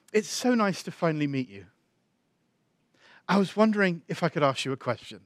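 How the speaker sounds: soft, gentle quoting of a kind woman. Retold or imitated. Voices Heidi gently opening the conversation.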